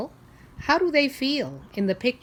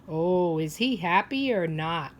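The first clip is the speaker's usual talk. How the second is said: plain reading voice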